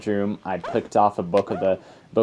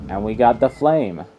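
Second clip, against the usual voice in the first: normal talking voice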